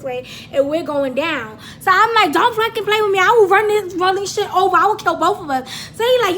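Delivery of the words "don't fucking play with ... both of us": loud, raised-pitch warning; dramatic threat. Retold or imitated. Passenger threatening to crash the car, loud mock rage. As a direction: warn him in hammed-up fury.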